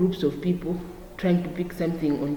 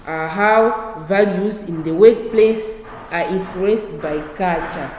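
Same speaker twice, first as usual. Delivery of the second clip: slow, loud, word by word; slow dictation; 4 dB louder, slower than usual. Shown as a demonstration. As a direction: dictate so students can write. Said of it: "teacher dictating notes slowly".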